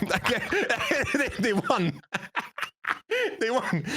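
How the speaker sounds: words broken by real laughter; laughing. Real, not acted. Storyteller laughing while recalling the rival team won. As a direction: giggle through the words.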